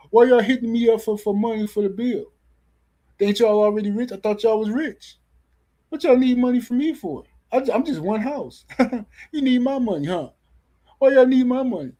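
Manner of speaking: high-pitched, laughing incredulity; ridiculous mock protest. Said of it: "This is how ridiculous A J fans sound".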